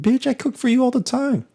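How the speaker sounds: very high squeaky indignant retort; mock outburst. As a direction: fire back in a shrill-high mock protest. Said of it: "I was like".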